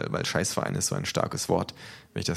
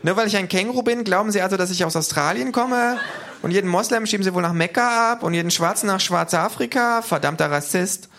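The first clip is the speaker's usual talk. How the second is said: very high, loud indignant rant; outraged kangaroo; 13 semitones higher, 5 dB louder than usual. Acted. Kangaroo accusing official of racism, loud high outrage. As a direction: rail at him in a high voice. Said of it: "ruft das Känguru empört".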